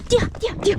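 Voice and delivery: running alongside, urging dog high and loud; excited urging. Real, not acted. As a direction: egg the dog on to run.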